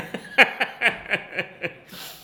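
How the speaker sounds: high breathy laugh; laughing at himself; real laugh. Real, not acted. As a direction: laugh out loud. Laughing at his own story.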